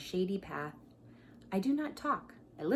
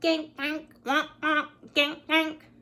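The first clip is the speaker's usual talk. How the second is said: high, loud little quacks; small duck calls; 7 semitones higher, 8 dB louder, slower than usual. Retold or imitated. Reading to children, small ducks calling high and loud. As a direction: honk like a small bold duck, higher and louder. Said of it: "They say".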